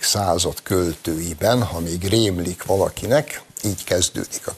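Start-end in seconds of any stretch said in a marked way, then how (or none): none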